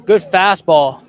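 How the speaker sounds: loud, slow admiring call of a good pitch; loud, slow, impressed announcer call. Real, not acted.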